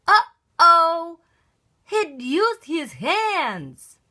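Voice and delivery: high surprised uh-oh, then slow. A narrator's reading. Narrator reacting to Froggy's handball, surprised. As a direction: let the uh-oh pop, then slow down.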